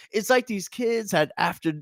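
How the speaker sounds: ordinary talk, nothing marked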